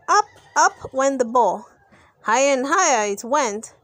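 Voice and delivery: ordinary delivery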